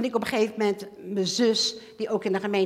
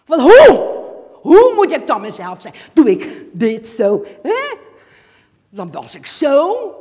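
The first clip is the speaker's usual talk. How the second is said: louder, exasperated shouting; angry outburst; 4 semitones higher, 13 dB louder than usual. Retold or imitated. Woman furious at being told to be herself, loud outburst. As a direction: rant at the demand to be herself. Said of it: "Ik was zo kwaad".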